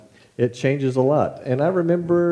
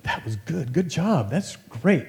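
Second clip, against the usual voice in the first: imitating gracious friend; 6 semitones higher than usual. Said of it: "he'd be like".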